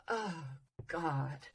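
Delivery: very soft, sinking groan; deflated demo. Shown as a demonstration. Coach demonstrating feeling in the voice, soft deflated sigh. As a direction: let it drop away, quiet and falling. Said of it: "they could talk like this".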